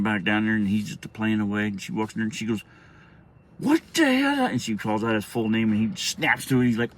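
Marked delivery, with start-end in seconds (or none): 3.6-4.5 high-pitched outraged mom's cry. Retold or imitated. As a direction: imitate a mother exploding at her kid. Angry mom yelling, retold.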